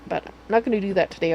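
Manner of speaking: normal talking voice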